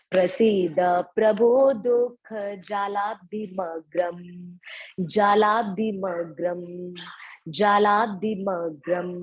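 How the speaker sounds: जालाब्धिमग्रम joined as one word, said slowly and evenly; slow careful recitation. Shown as a demonstration. Teacher repeating the joined word for students. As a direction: run जालाब्धि and मग्रम together smoothly. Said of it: "तो कैसे बोलेंगे?".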